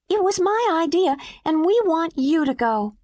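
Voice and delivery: put-on high child voice pressing the point; a kid insisting she gives her turn away; little girl insisting. A narrator's reading. Mimi urges the woman, high and insistent.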